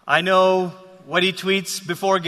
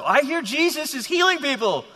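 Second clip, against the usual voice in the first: higher, eager imagined follower; a touch of excited rumour; eager hearsay; 8 semitones higher than usual. Acted. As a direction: lightly act an excited bystander reporting news. Voices an eager follower from afar.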